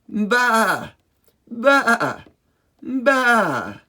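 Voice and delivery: loud, high bleating baa; sheep baa. Pretend. Reviewing sheep sounds for kids, loud high baas. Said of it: "The sheep says".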